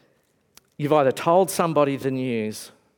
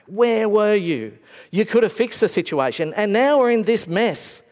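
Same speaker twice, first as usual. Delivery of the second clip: anguished reproach; 6 semitones higher, 3 dB louder than usual. Retold or imitated. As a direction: demand where he was. Mary accusing Jesus in grief.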